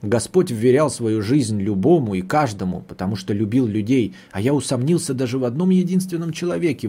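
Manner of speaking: ordinary delivery, nothing marked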